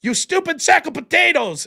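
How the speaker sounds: high, drawn-out cartoonish mock yell; yelled Stimpy insult. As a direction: yell it playfully in a put-on accent. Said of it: "please yell, ... in his accent".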